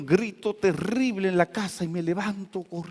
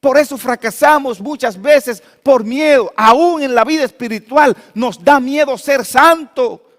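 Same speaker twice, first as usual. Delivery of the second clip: loud, high, fervent sermon voice; preaching at full force; 7 semitones higher, 9 dB louder than usual. Acted. Pastor exhorting the congregation against fear, fervent full-voiced preaching. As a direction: drive the warning about fear home to the church.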